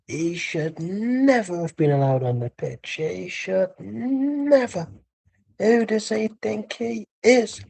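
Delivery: slow, drawn-out indignant fan; Ty's mannerisms. Acted. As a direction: stretch out the outrage, pitch climbing high. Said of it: "Then the mannerisms of Ty is like".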